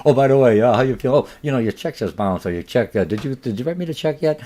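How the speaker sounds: loud, pushy opener about the check; a tactless seller who starts loud and then nags about payment; pushy salesperson. Acted. Bad salesperson follow-up call.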